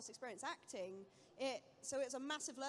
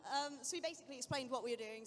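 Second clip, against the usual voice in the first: usual speaking voice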